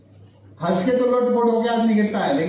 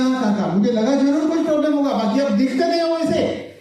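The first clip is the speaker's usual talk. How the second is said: sarcastic retort; 6 semitones higher than usual. Retold or imitated. Teasing the old man.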